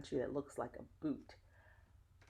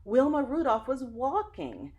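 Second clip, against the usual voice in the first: higher, louder, astonished exclamation; amazed gasp of news; 10 semitones higher, 10 dB louder, slower than usual. Acted. Churchgoers seeing Wilma walk, amazed and excited. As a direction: voice the stunned congregation.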